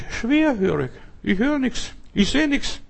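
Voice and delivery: high, soft put-on voice of someone playing deaf; mock deaf person. Preacher mimicking a believer who plays deaf, high and soft. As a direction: act like you hear and see nothing, high and hushed.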